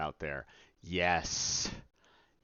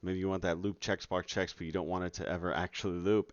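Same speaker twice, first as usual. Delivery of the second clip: usual speaking voice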